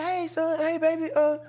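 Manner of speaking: high, chirpy motherly chatter; high-pitched motherly impression; mom's voice. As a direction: imitate a mom greeting her boy. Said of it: "she said, she said".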